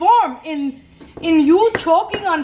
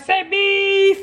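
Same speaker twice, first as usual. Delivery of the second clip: drawn-out, higher "beef" as if for the camera; slow, higher pose-for-the-picture beef; photo-time beef; 4 semitones higher than usual. Acted. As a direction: stretch "beef" like a photo call. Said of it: "Why can't be something else".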